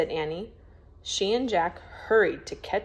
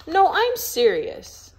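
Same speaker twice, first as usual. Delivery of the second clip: higher, louder, slow insistence; insistent; 11 semitones higher, 6 dB louder, slower than usual. Acted. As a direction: dig in and mean it. Jack insisting to Annie.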